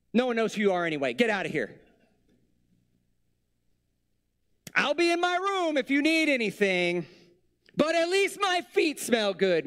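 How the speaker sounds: high, whiny pretend meltdown over a wasted gift; hammed-up high whiny fit; mock Jesus tantrum. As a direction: play a petty, sulky Jesus throwing a fit, pitched well up. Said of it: "he just said".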